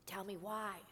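a hint of a frightened old miser; lightly voiced Scrooge. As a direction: ask it as a timid old man.

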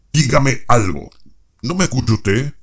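shouting. Acted. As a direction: yell the demand. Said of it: "no tiene por qué gritar".

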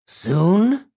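high, loud rasping hag; raspy monster voice. A narrator's reading. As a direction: rasp it like an old monster gargling phlegm. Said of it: "one of them asked in a raspy voice".

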